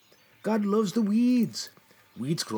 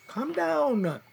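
higher, strained plea to himself; panicky self-talk; 8 semitones higher than usual. Acted. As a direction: try to calm down but the panic pushes up.

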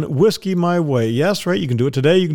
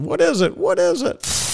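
high squeaky questioning voice; high excited repeat; 12 semitones higher than usual. Acted.

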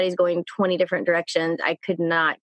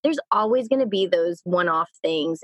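ordinary talk, nothing marked

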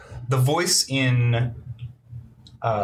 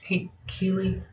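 put-on small child voice; 5 semitones higher, faster than usual. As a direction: ask shyly like a small child. GM voices child asking hug.

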